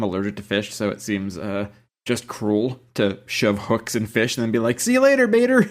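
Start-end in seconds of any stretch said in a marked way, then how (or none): 4.8-5.6 singsong mock goodbye. Mock farewell to the fish.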